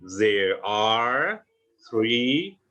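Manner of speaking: "There are three trees" very slowly, each word shaped; slow model sentence. Shown as a demonstration. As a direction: spell out the English sentence slowly for learners. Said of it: "Gitu kan bilangnya".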